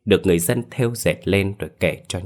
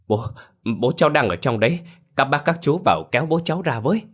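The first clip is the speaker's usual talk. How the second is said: higher, rushed frightened pleading; frightened plea; 7 semitones higher, faster than usual. A narrator's reading. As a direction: beg them to pull his father out. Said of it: "mặt mũi tái không nói rõ lời". (van nài hoảng hốt, gấp gáp)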